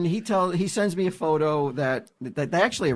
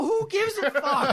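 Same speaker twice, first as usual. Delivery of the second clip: laughing; slower than usual. Retold or imitated. Retold blunt reply, higher and laughing.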